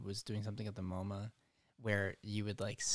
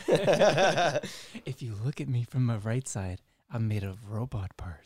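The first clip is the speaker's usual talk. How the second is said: slow, loud, languid art snob; languid pretentious art snob; 6 dB louder, slower than usual. Pretend. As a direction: drawl it like a pompous artist. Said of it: "my go-to pretentious art voice".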